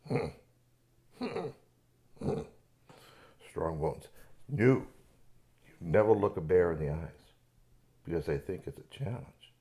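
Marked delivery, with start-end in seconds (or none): none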